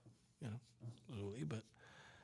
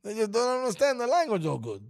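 higher mock Italian accent; 6 semitones higher, faster than usual. Host explaining how Italians talk, in a put-on Italian accent. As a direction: keep up the broken-English Italian act. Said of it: "You're doing better".

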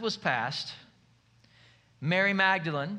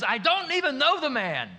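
raised, heated denial, a bit louder; mock angry outburst; 7 semitones higher, 4 dB louder than usual. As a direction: snap it out like you're cornered.

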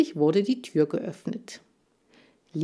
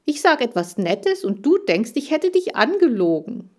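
indignant; 6 semitones higher, 4 dB louder than usual. Acted. Upset hamster still complaining.

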